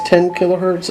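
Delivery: usual speaking voice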